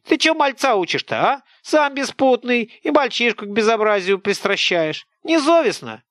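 high, loud village scold; an angry peasant woman tearing into a boy; scolding old woman. Acted. (сварливая тётка, громко и высоко)

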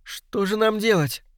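high imploring question; pleading. A narrator's reading. Young woman asks Poirot what to do, pleading. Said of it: "взмолилась Джейн".

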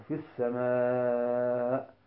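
في السماء with a sustained final vowel; lengthened madd. Shown as a demonstration. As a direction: hold the last aa of السماء long and level. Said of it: "وإذا وقف على نحو".

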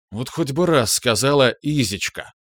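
ordinary delivery

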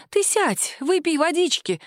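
high-pitched fussy aunt; fussing concern. A narrator's reading. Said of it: "засуетилась тётушка".

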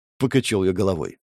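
ordinary delivery, nothing marked

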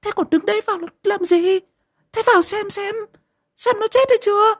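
put-on very high, shrill old woman's scolding; furious scolding. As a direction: berate the servants in outrage. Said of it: "trợn cả mắt".

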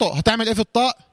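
ordinary delivery

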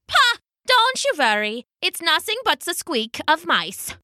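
high-pitched pompous scoff. Acted. Haddo to Hans about the hollering crowd, scornfully dismissive. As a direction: burst out high, then brush the worry aside.

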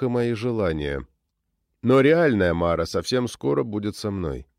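usual speaking voice

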